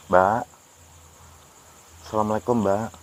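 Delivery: loud call, rising; sudden loud call. Real, not acted. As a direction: call to her from a distance. Calling out to the woman.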